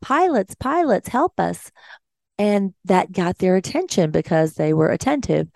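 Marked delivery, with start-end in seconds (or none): 0.0-1.7 mild mock yell, each word swooping high; high-pitched call for help without real volume; retold yell. Nurse hailing two passing pilots for help, mild retold yell. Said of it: "So I yelled out".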